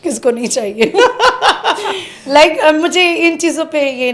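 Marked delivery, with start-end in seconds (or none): 0.9-2.2 a bright burst of laughter, pitched up; real amused laugh; high laugh. Real, not acted. Laughs at her own answer.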